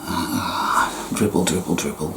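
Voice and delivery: mock dozing off. Acting out the woman who falls asleep on the sofa, drowsy and sinking. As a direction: let the voice sink into a sleepy dribble.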